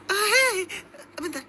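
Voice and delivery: very high, loud alarmed scream; panicked cry. Acted. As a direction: shriek it in panic. Alarmed at sudden danger.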